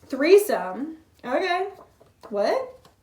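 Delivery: loud, high, startled questions; surprised. Real, not acted. Reader caught off guard by a card, loud surprise. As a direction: react in disbelief.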